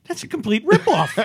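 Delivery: high, loud, laughing self-reproach; high-pitched comic outrage; mock indignation. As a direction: blurt it out loud and high, amused at yourself. Mock-indignant reaction to own audition.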